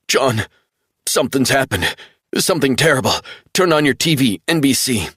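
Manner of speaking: urgent and shaken, pleading down the phone; distraught young man. A narrator's reading.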